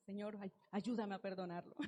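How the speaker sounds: a reluctant sigh of a prayer, half-hearted; mock whiny prayer. Wife praying to God about her husband, softly and half-heartedly. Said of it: "Y ir a la presencia de Dios".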